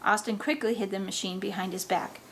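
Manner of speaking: ordinary delivery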